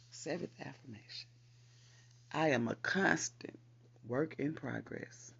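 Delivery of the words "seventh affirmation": ordinary talk, nothing marked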